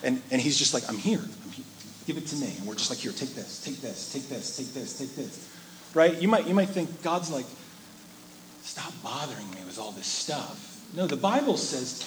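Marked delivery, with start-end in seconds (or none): 0.9-2.5 much softer, near-hushed offer; gentle invitation. Pretend. Preacher voicing God inviting the anxious, hushed and gentle. 3.0-5.5 much softer, lower repeated offering; hushed handing over. Pretend. Preacher voicing believers giving worries to God, hushed. 8.6-10.7 much softer, lower grumble; a quiet mock brush-off; hushed mock annoyance. Preacher voicing an imagined impatient God, softly mocking.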